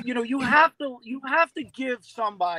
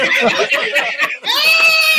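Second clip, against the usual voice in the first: big burst of laughter; loud laugh; 13 dB louder than usual. Real, not acted. Big laugh during talk.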